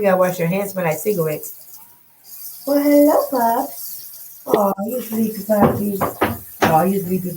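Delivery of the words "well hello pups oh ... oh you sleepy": puppy talk. Real, not acted. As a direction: fuss over the pups, starting high. Cooing to her puppies.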